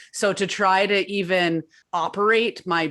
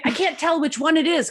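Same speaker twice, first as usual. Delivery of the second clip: flustered quote; 9 semitones higher than usual. Retold or imitated. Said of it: "I felt like a fool 'cause I was like".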